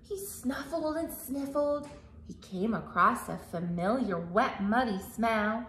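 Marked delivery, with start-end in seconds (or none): none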